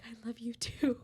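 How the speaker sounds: breathy whispered I love you too; awkward whispered goodbye to an online suitor; mock whisper. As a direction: whisper it awkwardly, half-meaning it. Awkward whispered reply, retold.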